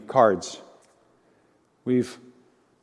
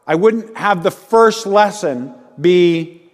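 normal talking voice

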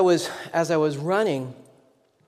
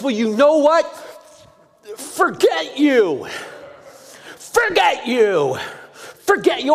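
furious rant; 7 semitones higher, 6 dB louder than usual. Retold or imitated. Angry stranger yelling at the pastor, furious shout. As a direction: rage at him, spitting each forget you. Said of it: "he's looking at me and he says".

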